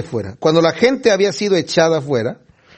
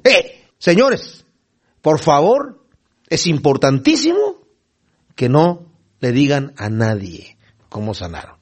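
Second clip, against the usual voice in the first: slow, spaced-out insistence, dropping lower at the end; emphatic; 3 semitones lower, slower than usual. Retold or imitated. Jesus strictly charging the healed blind men, emphatic. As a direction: open with a drawn-out "Eh", then weigh each phrase. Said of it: "es bien enfática en el griego".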